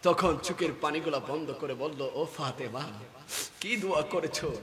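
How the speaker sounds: low, soft voice after a climax; hushed question. Retold or imitated. Preacher as the Prophet asking Fatema, hushed. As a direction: drop right down, gently spaced.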